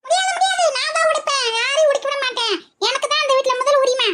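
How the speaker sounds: put-on high, childish insistence; stubborn granny. Old woman fighting the guest over who breaks it. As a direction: claim the right like a spoiled elder.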